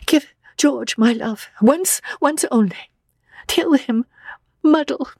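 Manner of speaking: high, broken farewell through tears; choked, high-pitched sorrow; tearful. A narrator's reading. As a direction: plead it in small tearful bursts. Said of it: "while the tears poured over her cheeks".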